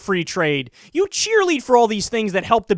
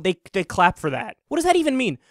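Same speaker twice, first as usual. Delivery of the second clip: ordinary talk, nothing marked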